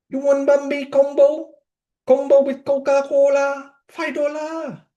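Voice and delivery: put-on very thick Vietnamese accent, very high-pitched; Long. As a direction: imitate a Vietnamese friend pitching a sale, high voice. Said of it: "in this very thick Vietnamese accent".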